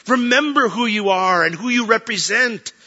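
loud, high exhortation; rising preacher's call; preaching at full force. Acted. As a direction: drive the charge home to the church.

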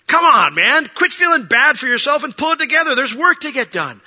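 loud, high-pitched pep-talk rebuke; exasperated scolding. Acted. Preacher giving Elijah the whack he deserved, loud scolding. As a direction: push hard, high and loud, to rouse him.

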